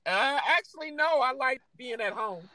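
high, mocking put-on voice, dryly correcting; skeptical audience woman. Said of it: "the women in the audience are staring back at them like".

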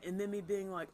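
usual speaking voice

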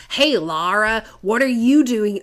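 loud, high mocking imitation; mock film-actor impression. Host mocking the actor greeting Lara, loud and hammed-up. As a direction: call it out in a hammed-up put-on voice. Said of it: "Yeah, he's like".

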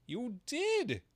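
high, loud Hatter. A narrator's reading. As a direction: snap back at the hare. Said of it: "said the Hatter".

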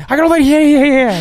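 very high, loud eager yelling; eager high shout. Retold or imitated. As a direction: shout it like the hero of the day. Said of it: "Someone's like".

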